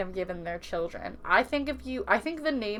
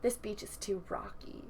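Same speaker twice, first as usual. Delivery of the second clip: snobby complaint; 4 semitones lower, 4 dB quieter than usual. Retold or imitated. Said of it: "are just like".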